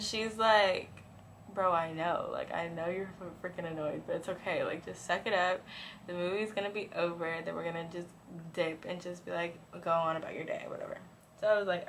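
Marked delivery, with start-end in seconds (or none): 1.5-11.0 low, soft, resigned reassurance; calm your friend down under your breath; quiet placating. Retold or imitated. Best friend saying suck it up, quietly placating.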